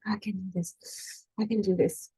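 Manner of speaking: quietly talking herself down; calm self-talk. Retold or imitated. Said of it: "she switches out and she's like".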